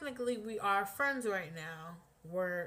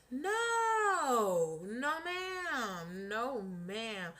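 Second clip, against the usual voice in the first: sweeping, stretched-out "no, ma'am"; emphatic refusal; much slower than usual. Real, not acted. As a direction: draw out each refusal, stepping down in pitch. Emphatic refusal in retold rant.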